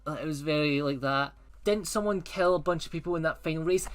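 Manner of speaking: normal talking voice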